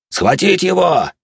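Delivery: high, loud, drawn-out command; shouted command. Acted. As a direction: yell the order from the wall. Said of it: "снова послышался приказ".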